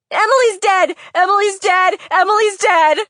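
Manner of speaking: very high, loud frantic shout; yelling as loud as possible. Acted. Girl screaming the news at her friend's mother, high and loud. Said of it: "yelled out as loudly as I could".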